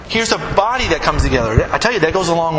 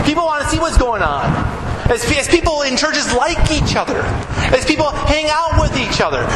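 raised, ringing preacher's voice, pushing higher; fervent preaching; 7 semitones higher than usual. Acted. Pastor urging his congregation, fervent preaching. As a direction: preach it out with growing fire.

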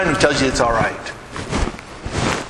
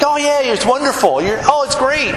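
gushing fake praise; 7 semitones higher than usual. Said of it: "friends will be like".

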